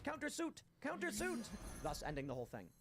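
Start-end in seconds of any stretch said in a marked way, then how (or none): 0.0-1.5 high, sing-song cry of countersuit; Niles crying countersuit. Retold or imitated. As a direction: chirp countersuit in a high, triumphant voice. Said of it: "And he goes".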